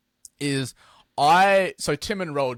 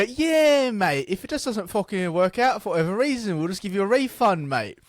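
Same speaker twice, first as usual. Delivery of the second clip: high, exaggerated mock British voice; British accent; 7 semitones higher than usual. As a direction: ham up a British bloke promising refunds. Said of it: "he's got this British accent".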